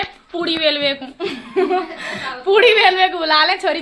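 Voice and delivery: amused, real laughter breaking into the words; talking through a laugh. Real, not acted. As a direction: say it while laughing. Laughs about girls rolling puris.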